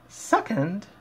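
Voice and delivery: a high quizzical echo; Rarity frowning. Acted. Fan voicing Rarity questioning Dash, high and puzzled. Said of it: "Rarity frowned".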